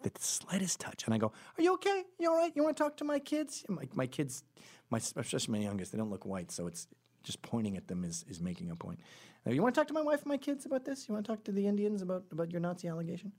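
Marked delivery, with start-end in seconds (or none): 1.6-3.6 much higher, pestering questions; retold anxious-sounding concern, pitched way up; high-pitched checking. Retold over-solicitous question to woman. 9.4-13.3 fast, high-pitched challenge; retold rapid-fire pestering; high, insistent questioning.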